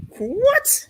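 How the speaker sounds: high-pitched, incredulous retold "what"; mock-stunned squeal of disbelief; taken aback. Reacting to the friend's dig at his girlfriend, taken aback. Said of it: "I was like".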